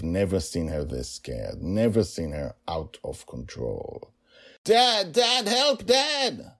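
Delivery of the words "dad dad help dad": high, loud panicked screams; frightened child screaming; terrified scream. Acted. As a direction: scream for dad in sheer terror. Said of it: "Her voice broke my heart".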